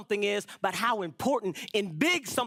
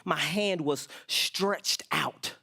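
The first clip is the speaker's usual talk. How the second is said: slow and drawn out; 6 semitones lower, much slower than usual. Acted. Repeated psalm line slowly.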